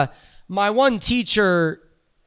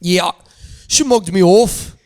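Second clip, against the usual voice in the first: mock British reality-show contestant; put-on British grumble; British accent. Shown as a demonstration. [name] complains about birds mugging him off in a British accent. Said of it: "switch up to the British".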